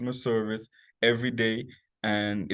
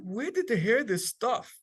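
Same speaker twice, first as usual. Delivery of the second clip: higher, baffled disbelief; baffled aside; 10 semitones higher than usual. Retold or imitated. Chef wondering where clients heard of conch, baffled. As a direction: pitch it up, puzzled and amused. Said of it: "And I'm like".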